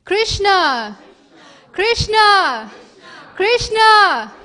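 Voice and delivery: drawn-out shouts of the name. Acted. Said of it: "she calls".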